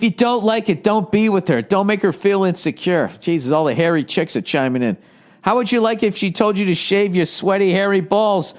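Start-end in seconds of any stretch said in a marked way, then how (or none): none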